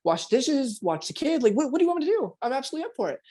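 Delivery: sincerely up for every chore; new partner eager to help. Retold or imitated. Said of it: "you're sincere".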